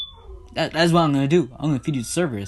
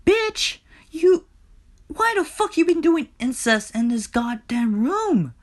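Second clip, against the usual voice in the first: furious tirade; 9 semitones higher than usual. Acted. Hades rages at Persephone over incest, furious tirade. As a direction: tear into them with escalating threats.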